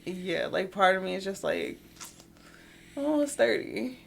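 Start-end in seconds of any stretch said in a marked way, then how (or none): none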